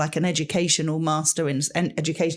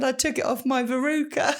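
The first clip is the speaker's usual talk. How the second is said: higher pitch, breaking into laughter; little boy punchline; 6 semitones higher than usual. Retold or imitated. Pupil confessing to teacher, lightly imitated and laughing. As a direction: deliver the boy's line through a laugh. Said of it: "He said".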